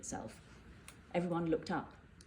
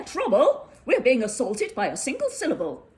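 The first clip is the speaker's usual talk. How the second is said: loud, much higher indignant outburst; mayor outraged at the bird, spluttering; 5 semitones higher, 9 dB louder than usual. Acted. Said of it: "spluttered the mayor".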